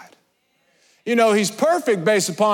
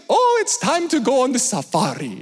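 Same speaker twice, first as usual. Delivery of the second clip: high, bubbly tour guide impression; Jenga; 5 semitones higher than usual. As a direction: imitate a cheerful, excitable safari guide. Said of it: "the most bubbly person".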